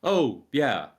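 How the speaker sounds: loud, breezy mock brush-off; dismissive. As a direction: imitate a game hero shrugging it off. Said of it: "it's just so dismissive of the situation".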